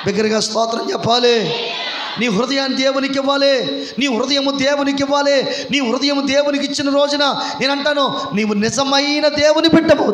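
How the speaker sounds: building sermon cry, rising then slowing; preaching at full force. Acted. Pastor exhorts the congregation toward baptism, building to a shout. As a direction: drive the list home to the congregation.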